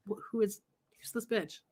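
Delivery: hushed imitated gossip; mock muttered aside. Relatives eyeing the newcomer, mock hushed mutter. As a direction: say it under your breath, soft.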